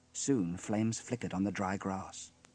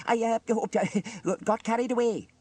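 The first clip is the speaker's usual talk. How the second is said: high, flustered stammer; 12 semitones higher, 6 dB louder than usual. A narrator's reading. Elvis apologises to Trevor, stammering. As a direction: stutter helplessly into an apology. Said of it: "stammered Elvis".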